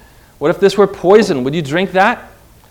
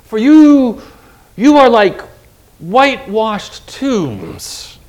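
hotter rebuke. Retold or imitated. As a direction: build the heat and hammer each word. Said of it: "he laid into him even again and further and hotter".

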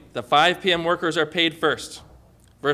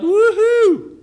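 high, loud whoop of joy; yelled cheer; 8 dB louder than usual. Acted. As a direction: whoop out in jubilation. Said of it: "jumped up and yelled".

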